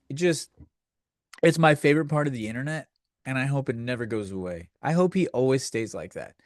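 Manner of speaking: normal talking voice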